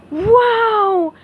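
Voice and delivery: high, loud awed exclamation; amazed. Acted. Patrick marvels at the bubble wand, loud awe. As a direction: gasp in wonder. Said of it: "his eyes widened".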